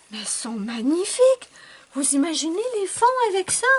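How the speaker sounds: loud, high and fast with glee; excited gushing. Real, not acted. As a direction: rave about the possibilities.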